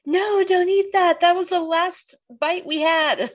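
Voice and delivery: hammed-up desperate plea; mock pleading. Retelling a character stopping Joey, high slow mock plea.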